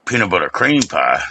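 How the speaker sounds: normal talking voice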